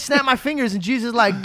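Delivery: normal talking voice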